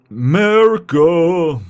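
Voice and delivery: hammed-up bad American accent; mock American accent.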